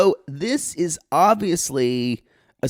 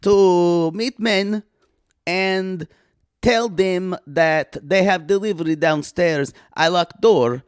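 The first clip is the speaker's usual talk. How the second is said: put-on deliberate comic Eastern European accent; cousin Olga; 4 semitones higher than usual. Visiting cousin explaining her lock out, deliberate accented voice.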